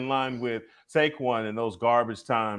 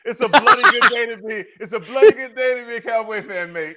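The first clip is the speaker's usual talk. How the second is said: loud, high-pitched hammed-up British; mock British accent; 10 semitones higher, 5 dB louder than usual. Co-host clowning for laughing podcast friends, mock British accent. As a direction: put on a jolly, badly faked British accent. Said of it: "tried to do a British accent".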